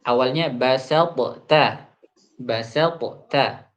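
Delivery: basalta said slowly, ta sounds kept heavy; drawn-out basalta with the heavy ta kept; careful Quran recitation demo of basalta. Shown as a demonstration.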